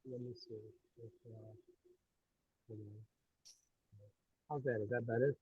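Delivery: muffled, faint bad connection; like talking from underwater; underwater sound. Real, not acted. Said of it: "Sounds like you're underwater".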